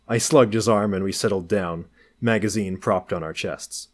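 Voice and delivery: usual speaking voice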